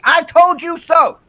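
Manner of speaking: sing-song I told you so; high, slow gloating. Pretend.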